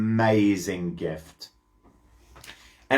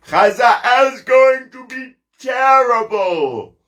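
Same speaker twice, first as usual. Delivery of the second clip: higher and louder, a big emphatic storyteller's warning; dramatic warning; 9 semitones higher, 5 dB louder than usual. Acted. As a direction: make the children gasp at it.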